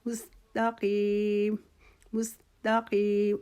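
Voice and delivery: مستقیم with میم سین تا light, slow; thin letters. Shown as a demonstration. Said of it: "تینوں حروف ... باریک پڑھنے ہیں".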